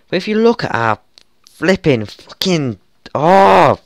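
high, loud, cut-off cry; real boiling-over frustration; frustrated outburst. Real, not acted. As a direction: splutter in rage.

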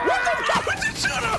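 very high-pitched shriek of shock; real jump-scare outburst; startled scream. Real, not acted. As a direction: scream it out in sudden fright.

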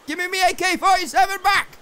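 high-pitched demand; indignant yell. Real, not acted. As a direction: yell it like you've been robbed. Demand repeated louder, gun lost.

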